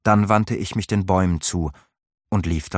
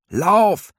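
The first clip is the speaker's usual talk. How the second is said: high, loud call from inside the crowd; 13 semitones higher, 6 dB louder than usual. A narrator's reading. Halvar calling out from amid the ravens. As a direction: cry it out over the ravens. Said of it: "hörte ich ihn inmitten der Rabenschar rufen".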